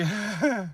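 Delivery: quick amused laugh; short chuckle. Real, not acted. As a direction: chuckle to yourself. Laughs after joking sign-off.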